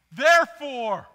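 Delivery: "Therefore" proclaimed high and loud; stress on Therefore. Real, not acted. Preacher revealing Romans twelve one, loud stress. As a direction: hit "Therefore" hard like a revelation. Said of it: "announcing the key word".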